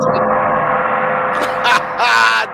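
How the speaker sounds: big unvoiced laugh bursting out; howling laugh; loud burst of laughter. Real, not acted. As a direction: crack up loud at the joke. Host cracking up at roast.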